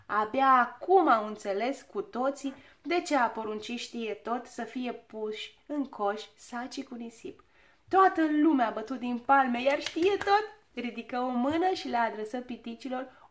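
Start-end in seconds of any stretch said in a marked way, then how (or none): none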